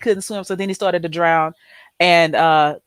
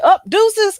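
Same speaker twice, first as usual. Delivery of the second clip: mock exit; 13 semitones higher, 5 dB louder than usual. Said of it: "but then he's like".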